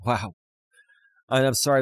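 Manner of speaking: ordinary delivery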